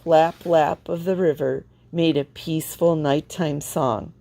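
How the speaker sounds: usual speaking voice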